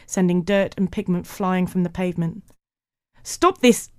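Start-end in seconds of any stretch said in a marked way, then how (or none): 3.2-3.9 very high, loud recorded command; high-pitched, punched machine order; tape recorder voice. A narrator's reading. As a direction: pipe it out like a playback. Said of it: "said Carl's tape recorder".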